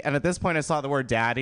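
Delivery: normal talking voice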